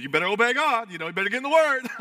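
loud, high, hammed-up nagging; mock stern warning. Legalist pushing others to obey God, mock stern warning. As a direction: scold like an overzealous churchgoer, climbing in pitch. Mocking a joyless obedience mentality.

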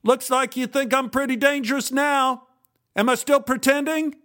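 high, strained mocking yell; yelled taunt. A narrator's reading. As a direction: yell a challenge after someone fleeing. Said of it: "Jim stopped and yelled".

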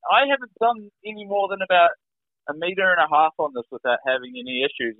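high-pitched retold objection; anxious protest. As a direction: object in disbelief, pitch up high then easing.

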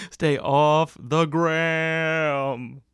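slow, drawn-out booming slogan; mock announcer. To listeners, a slow booming mock warning. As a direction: stretch it out like a mock PSA.

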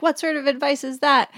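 high-pitched imitated annoyance; mock indignation. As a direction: act out her indignant question, voice jumping up. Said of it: "Sandy gets angry".

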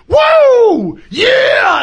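very high, loud whooping yell; whooping thrill. Retold or imitated. Rally driver after rolling nine times, thrilled shouting whoop. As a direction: shout with glee mid-crash. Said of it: "then he's like".

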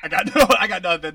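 fast giggling words; loud laughing. Real, not acted. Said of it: "I love that my voice sounds like that".

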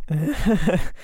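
short jittery nervous laugh. Acted. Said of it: "maybe it was a nervous laugh?".

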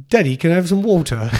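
put-on a light, posh English kid accent in the style of Peppa Pig. Dad imitating his daughter's Peppa Pig English accent. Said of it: "her accent came from Peppa Pig".

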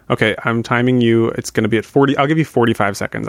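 normal talking voice

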